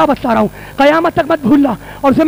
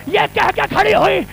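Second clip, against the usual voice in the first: wailing lament; 6 semitones higher, 4 dB quieter than usual. Acted. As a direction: wail to her dead father. Zainab greets her father, wailing.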